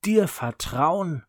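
high, loud slow scorn; scornful. A narrator's reading. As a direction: sneer the word back at her. Brother mocks her trust.

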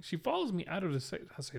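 normal talking voice

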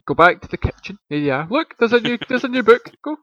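high-pitched mock telling-off; retold yelling. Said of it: "yell at me".

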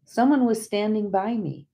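plain reading voice

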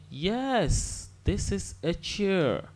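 usual speaking voice

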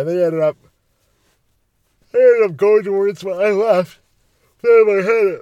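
real yawn stretching the words high and loud; talking through a yawn. Real, not acted. Telling a parking lot story, yawning through it. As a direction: try to keep talking while yawning. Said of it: "well, I'm yawning".

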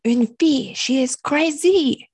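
hammed-up French accent, stretching the last word; French guy. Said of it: "And he kept saying".

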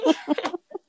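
a high, bubbly burst of laughter; giggling high in disbelief. Real, not acted.

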